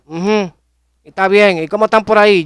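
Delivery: half-hearted impression of a curt father; curt, dry reply. Father answering his son on the phone, a curt dry reply.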